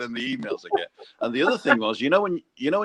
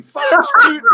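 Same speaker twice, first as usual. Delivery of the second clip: much higher, louder mock Scottish accent for "Fax Putin"; a German doing a broad Scottish accent; Bavarian with a Scottish accent; 11 dB louder than usual. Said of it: "called me up with a Scottish accent saying".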